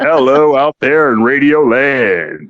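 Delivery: slow, deep radio host. Pretend. Host greets listeners in his radio voice. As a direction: purr it out like an old DJ. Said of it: "switch to the radio voice".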